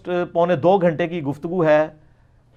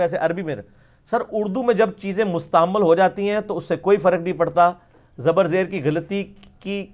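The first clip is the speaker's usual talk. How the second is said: normal talking voice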